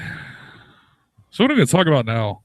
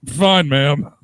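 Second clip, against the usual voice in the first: louder, mock-polite cheer; hammed-up cheery reply; 3 semitones higher, 6 dB louder than usual. Man answering an old woman's greeting, mock cheerful. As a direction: answer with put-on joviality.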